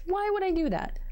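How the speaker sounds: asking herself in disbelief why she ignores problems, high and incredulous; incredulous self-question. Real, not acted. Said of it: "repeated self-question, first take".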